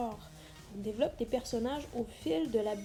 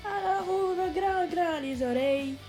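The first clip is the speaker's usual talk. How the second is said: emphatic call; 6 semitones higher, 5 dB louder than usual. Acted. Teacher calls for attention.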